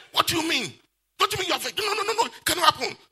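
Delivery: high, loud mock quarrel over a pie. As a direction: play the bickering leader, high and loud. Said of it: "by the voice of the leader raised".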